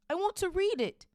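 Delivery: high, loud angry demand; indignant boy's outburst; furious. A narrator's reading. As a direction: insist hotly, voice shooting up. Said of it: "said Harry furiously".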